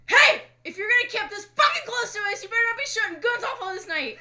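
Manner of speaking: high, put-on voice retelling the yell; a hammed-up version of her own scolding; badly faked reenactment. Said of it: "No, not even close".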